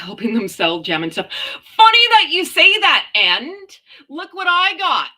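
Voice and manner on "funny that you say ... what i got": loud, high, gleeful announcement; belted out high with excitement; shouting excitedly. Real, not acted. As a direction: shout it out like a big reveal. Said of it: "Am I shouting?".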